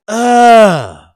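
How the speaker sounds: loud scornful grunt; a loud, dismissive uh. Retold or imitated. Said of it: "like, loudly".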